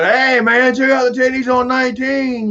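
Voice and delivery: high, leering catcall; retold neighbor. Neighbor catcalling the narrator, high and leering. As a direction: pitch it up like the sleazy neighbor. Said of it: "The, the one".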